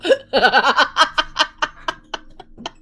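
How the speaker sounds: long loud laughing fit; loud laughter. Real, not acted. Mom laughing at herself, loud and long.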